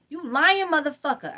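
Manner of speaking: high furious scream. A narrator's reading. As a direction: yell at him in fury. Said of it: "I screamed at him".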